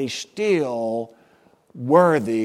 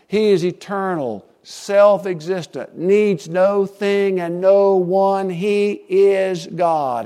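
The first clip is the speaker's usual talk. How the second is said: slow, loud, measured proclamation; a preacher declaring God's greatness; preaching at full force; 5 semitones higher, 6 dB louder, slower than usual. Acted. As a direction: proclaim every word like a creed.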